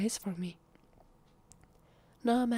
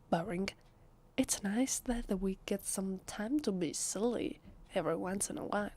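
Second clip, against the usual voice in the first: ordinary talk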